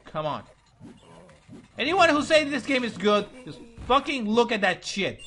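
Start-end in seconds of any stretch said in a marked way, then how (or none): 1.8-5.2 angry rant. Real, not acted. Ranting at game's defenders.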